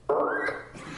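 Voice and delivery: very high-pitched guinea pig squeal; goblin guinea pig squeal. Retold or imitated. Actor trying out a goblin sound, guinea pig squeal. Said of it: "What does a goblin sound like".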